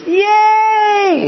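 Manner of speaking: loud, very high-pitched cheer. Acted. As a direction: cheer like an adult applauding a baby's little victory. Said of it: "everybody goes".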